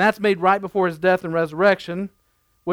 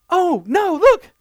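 much higher, louder sudden alarm; mock alarmed wife; 10 semitones higher, 5 dB louder, slower than usual. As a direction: imitate the wife's startled cry in the car. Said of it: "she's like".

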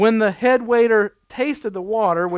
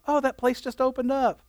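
light casual wife; 4 semitones higher, 5 dB quieter than usual. Retold or imitated. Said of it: "she's like".

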